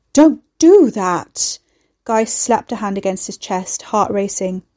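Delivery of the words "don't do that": loud, slow, drawn-out yell of fright; jumpy shout of alarm; startled shout. Acted. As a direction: blurt it out in shock at the phone. Guy startled by phone voice.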